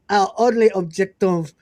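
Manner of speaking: put-on laughing through a French accent. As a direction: read it in a hammy French accent. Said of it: "I'll read them in a French accent".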